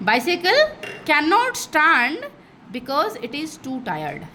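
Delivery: slow, spaced-out reading; slow demo read. Shown as a demonstration. Teacher stretching out the pun for the class. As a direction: pause before the punchline. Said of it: "मैं ऐसे बोलूँगी".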